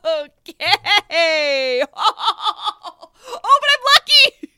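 high, loud giddy laughter through the words; laughing hard. Real, not acted. Player laughing at her lucky roll. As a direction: crack up at the roll.

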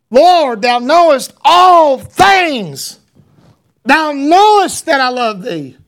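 loud, high, slow, cracking plea; broken. Acted. Peter answers Jesus a third time, broken and pleading. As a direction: pour out a broken heart, drawn out. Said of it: "I believe he was broken".

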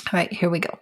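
usual speaking voice

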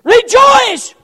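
loud, high cries of rejoice; exhorting preacher. Real, not acted. Preacher urging congregation with scripture, shouted exhortation. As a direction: belt each rejoice, then ease off.

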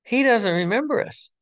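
goofy guy's voice. Retold or imitated. A goofy guy teasing the newcomer, high and amused. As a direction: pitch it way up like a giggly goofball. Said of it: "he goes".

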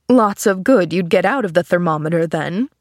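usual speaking voice

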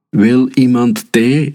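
high, drawn-out offer called out; narrated sing-out shout; mild storybook yell. A narrator's reading. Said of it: "gilde Bertie".

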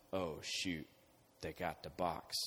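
low, hushed, dismayed aside; scared enemy. Retold or imitated. Philistines hearing the enemy camp cheer, hushed and scared. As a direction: drop low like frightened soldiers.

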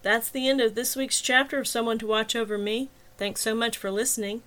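cheerful podcast outro; podcast sign-off. Acted. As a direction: wrap up the episode for listeners.

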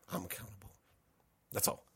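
very soft, tearful admission; hushed owning of the mistake; quietly accountable. Retold or imitated. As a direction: say it almost under your breath. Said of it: "I was teary-eyed".

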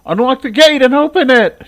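mother orders her son to open the gate, loud and high; demanding mother. Acted. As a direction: bark the command from the car. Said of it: "she demanded".

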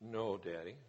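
put-on soft, very quiet little girl; a tiny, hushed "Yes, Daddy" like a small daughter; child voice. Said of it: "Do you think they love".

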